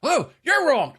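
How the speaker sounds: high, indignant comeback; mock arguing yell. Arguing over who was wrong, high indignant retort. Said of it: "he's yelling back and forth".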